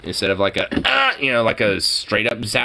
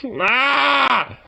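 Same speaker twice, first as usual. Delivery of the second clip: startled yelp; 13 semitones higher than usual. Real, not acted. Shocked reaction to the list.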